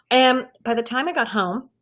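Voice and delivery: ordinary talk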